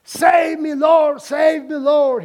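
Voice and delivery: high, loud cry for help; desperate shout. Acted. Peter sinking in the waves, shouting to Jesus for help. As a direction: yell it out as you sink. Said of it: "he shouted".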